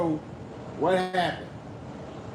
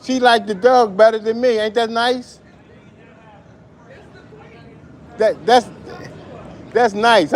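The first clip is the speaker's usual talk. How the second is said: excited rant; 6 semitones higher, 4 dB louder than usual. Real, not acted. Addressing radio host, ranting.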